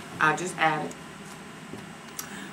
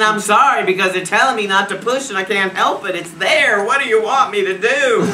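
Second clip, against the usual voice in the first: loud, higher, laughing retold protest; exasperated protest; 5 semitones higher, 8 dB louder than usual. Woman in labour to her son's father, exasperated protest. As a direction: protest helplessly at full voice.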